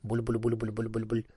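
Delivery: low, soft bubbling burble; gurgling water imitation. A narrator's reading. Narrator imitating a cucumber drinking, soft gurgle. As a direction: imitate water glugging, quietly and evenly. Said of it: "снова стал пить зелёную воду".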